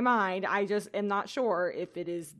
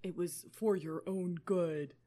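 put-on slow, softer old man voice; 3 semitones lower, 5 dB quieter, slower than usual. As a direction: read it like a weary old mentor. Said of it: "do I do my old man voice?".

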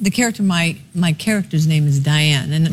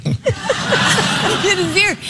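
words breaking into a high laugh; 12 semitones higher, much slower than usual. Real, not acted. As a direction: giggle through the line. Laughing over her own story.

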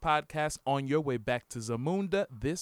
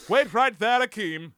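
higher and louder, a grand royal command; royal movie father; 8 semitones higher, 9 dB louder than usual. Pretend. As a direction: call out like a king stopping his son. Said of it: "theirs is actually more British".

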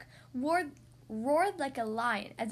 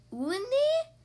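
puzzled question; 12 semitones higher, 6 dB louder than usual. Acted. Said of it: "the girl asked, scrunching up her face".

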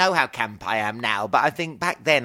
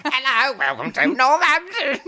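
mock seagull welcome; 12 semitones higher, 5 dB louder than usual. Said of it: "like a seagull colony".

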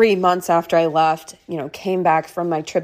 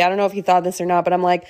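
ordinary talk, nothing marked

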